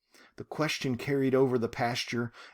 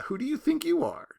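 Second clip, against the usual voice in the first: high mock challenge; 14 semitones higher than usual. Teacher mimics the echo question.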